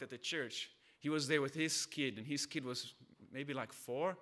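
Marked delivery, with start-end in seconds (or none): none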